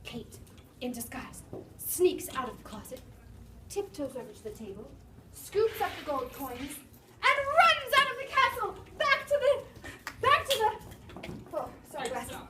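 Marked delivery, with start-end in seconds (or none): 0.0-4.9 hushed. A narrator's reading. Narrating Kate sneaking out. 7.3-11.6 excited rush. A narrator's reading. Narrating Kate's escape.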